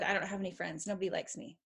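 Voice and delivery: put-on quiet, dejected kid's whine; mopey kid. Mother imitating a sulking teen, quiet and dejected. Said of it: "come home to your parents and said".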